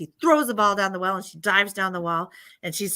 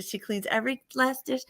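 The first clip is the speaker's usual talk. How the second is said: ordinary delivery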